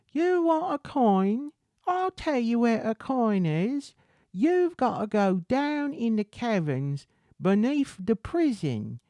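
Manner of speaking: high, loud Cockney lady; Cockney accent. Acted. Obstinate lady sending a beggar to the caverns, Cockney accent. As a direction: pitch it up in a hammed-up Cockney. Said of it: "I'm getting more Cockney right now".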